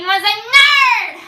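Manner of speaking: high, loud and slow on NERD; stretched shocked nerd; shocked reveal. Acted. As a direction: drop the scandal word with a gasp.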